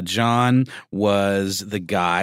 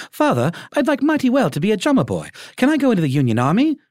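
much higher, eager little English schoolboy; high childish British accent asking father; 13 semitones higher, 4 dB louder, faster than usual. Acted. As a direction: plead like a small boy from an English boarding school. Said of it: "like, a British schoolboy accent".

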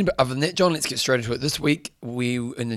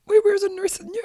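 high squeaky mock disbelief; squeaky incredulous; faster than usual. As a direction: pitch it way up in fake outrage. Mock outrage at co-host's notes.

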